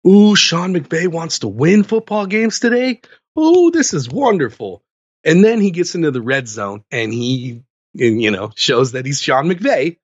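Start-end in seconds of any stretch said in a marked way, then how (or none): 0.1-4.8 high, bright sarcastic glee; mock delighted. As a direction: sell it like a thrilled fan who knows better. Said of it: "And I'm like".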